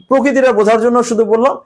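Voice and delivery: usual speaking voice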